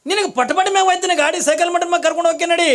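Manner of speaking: loud, high acted parent bargaining; desperate comic plea to a stubborn child; frantic coaxing. Father bargains with stubborn crying son.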